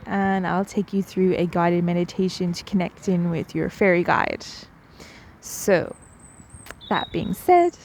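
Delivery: normal talking voice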